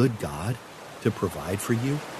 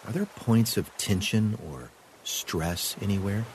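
calm meditative tone. Acted. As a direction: guide the breath calmly. Guided breathing exercise.

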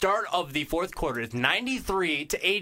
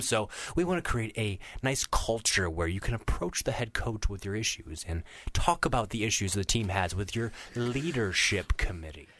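lower, understated mock-sincere spiel; mock reasonable; 6 semitones lower, 3 dB quieter than usual. Host imitating the coach's committee pitch, mock reasonable. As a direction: parody a coach's earnest pitch, dropped low.

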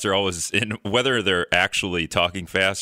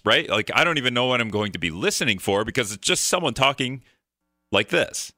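ordinary delivery

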